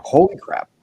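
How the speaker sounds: high, loud retold shock; alarmed exclamation. Patient retelling his own stroke discovery, alarmed exclamation. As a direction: exclaim it in startled disbelief.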